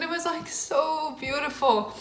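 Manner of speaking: real happy tears welling up; voice breaking with emotion. Real, not acted. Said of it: "I need to get myself together".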